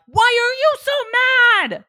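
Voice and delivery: slow, high, full-volume shout; drawn-out hammed-up angry yell; mock angry yell. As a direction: imitate a mad parent shouting at a child. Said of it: "you get mad and are like".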